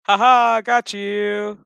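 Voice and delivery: loud, high, drawn-out crowing; mock triumphant gotcha. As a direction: crow it in hammed-up imitation. Acts out character's triumphant gotcha.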